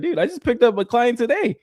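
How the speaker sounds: high, bragging delight; boasting to a friend, high and excited; retold excitement. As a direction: brag about the new client. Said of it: "I was like".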